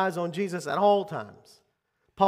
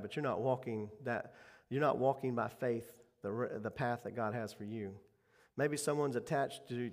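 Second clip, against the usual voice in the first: soft, earnest prayer voice; closing prayer; 3 semitones lower, 5 dB quieter than usual. Acted. Pastor prays over the seniors, soft and earnest. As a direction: pray quietly over the congregation.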